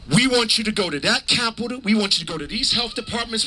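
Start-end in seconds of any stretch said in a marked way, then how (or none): none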